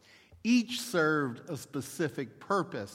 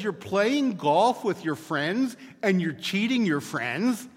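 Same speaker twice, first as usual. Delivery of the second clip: mock-appalled wife, higher and louder; hammed-up shock at her husband; 5 semitones higher, 6 dB louder than usual. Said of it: "his wife is appalled".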